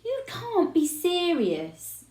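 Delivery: high, slow disbelief; can't believe what dad is doing; incredulous protest. A narrator's reading. Son protesting to his father, high and incredulous.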